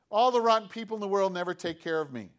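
hammed-up rant of self-pity; whiny complaining. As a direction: blurt it out as a sulky grievance. Sick man's complaint acted again.

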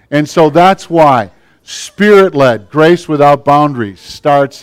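loud, high preaching; fervent sermon climax; preaching at full force. Real, not acted. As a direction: preach it out to the whole church.

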